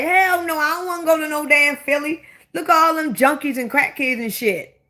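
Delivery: high-pitched, indignant acted reaction; emphatic refusal. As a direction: act out a quick, appalled refusal. Voicing own refusal to visit Philly.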